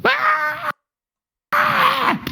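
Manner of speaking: high-pitched mock tantrum cry; hammed-up kid crying out; mock child wail. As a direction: wail like a toddler denied ice cream. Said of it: "What does the kid experience?".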